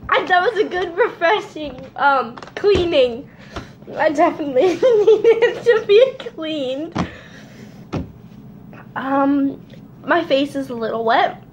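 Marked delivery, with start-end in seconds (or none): 4.0-7.1 slow, higher-pitched, broken by a laugh. Real, not acted. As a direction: get it out through laughter. Laughing after water spill.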